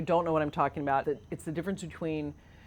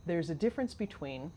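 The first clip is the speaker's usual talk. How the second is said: ordinary delivery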